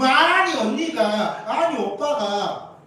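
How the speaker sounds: 크고 길게 끄는 아이들의 남 탓; 부모에게 이르듯 목청 높여 말끝 늘이기; 억울한 형제 흉내. Acted. 형제끼리 서로 탓하는 흉내. (억울하다는 듯 크게 늘여 따지는 흉내)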